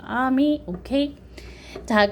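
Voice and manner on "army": "army" as "ah-mee", R left out; weak R. Shown as a demonstration. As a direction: let "army" rise high with a silent R. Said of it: "R은 좀 약하게".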